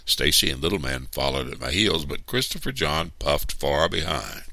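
ordinary delivery, nothing marked

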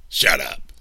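loud, abrupt snap; snapped. A narrator's reading. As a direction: cut them off loudly. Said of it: "Mister Anderson snapped".

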